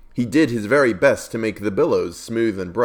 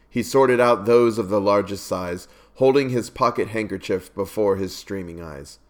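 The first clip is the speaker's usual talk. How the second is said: ordinary delivery, nothing marked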